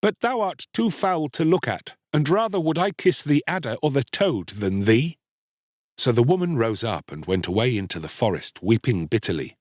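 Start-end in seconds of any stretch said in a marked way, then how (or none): 0.0-5.2 put-on higher-pitched haughty boy; disdainful Star-Child. A narrator's reading. Boy refusing to kiss his mother, disdainful. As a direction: refuse with cold disgust.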